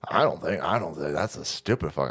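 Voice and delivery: mock deep voice. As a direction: sink the voice way down to play the dumb opponent.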